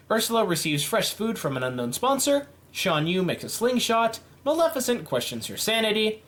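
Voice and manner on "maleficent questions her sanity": ordinary talk, nothing marked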